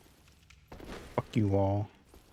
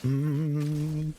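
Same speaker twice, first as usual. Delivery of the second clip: high held hum before a strike; wind-up hum; 5 semitones higher than usual. Pretend. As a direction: hold a high, wavering "mm".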